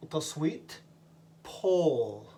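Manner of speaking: ordinary talk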